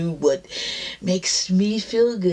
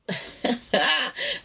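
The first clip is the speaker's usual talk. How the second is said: light laugh; 6 semitones higher, 3 dB quieter than usual. Real, not acted. Laughs after pep line.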